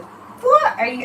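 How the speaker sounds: high, slow disbelieving question; mock baffled outrage at the show; retelling an incredulous "what are you?"; incredulous retort. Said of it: "I was like".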